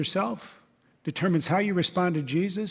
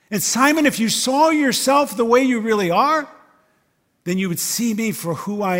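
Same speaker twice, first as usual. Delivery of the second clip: urgent appeal; 6 semitones higher, 6 dB louder than usual. Acted. Jesus urging Simon.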